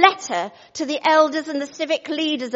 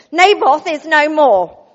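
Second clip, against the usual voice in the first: loud, slow and solemn; grand pronouncement; 3 semitones higher, 10 dB louder, slower than usual. Acted. Jezebel grandly announcing Naboth's death to Ahab. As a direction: announce it like a herald of doom. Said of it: "I expect she didn't speak like that".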